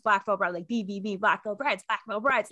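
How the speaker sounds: ordinary talk, nothing marked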